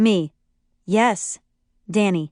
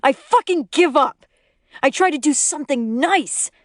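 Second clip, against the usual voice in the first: higher, frustrated outburst; exasperated yell; 6 semitones higher than usual. A narrator's reading. Danny giving up on his wife, exasperated yelling. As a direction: give up with a raised voice. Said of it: "Danny yells".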